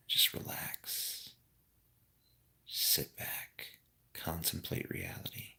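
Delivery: soft unvoiced whisper. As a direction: whisper it like a calm guru. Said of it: "sometimes it's nice to just be quiet".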